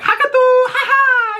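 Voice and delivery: mock old lady giggle. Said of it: "But the girls are like".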